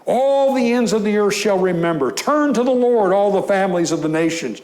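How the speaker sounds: preaching scripture reading. Acted. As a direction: lift the verses up to the congregation. Reads the psalm at full force.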